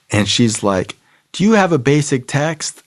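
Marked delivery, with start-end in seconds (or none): 1.3-2.8 high, put-on questioning voice; demanding woman. Woman checking on newcomer's book, demanding. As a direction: imitate a woman grilling a newcomer.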